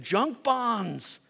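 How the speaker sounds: mock broker's scoff; scornful dismissal. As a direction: brush him off like a bad investment. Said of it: "We looked at him and said".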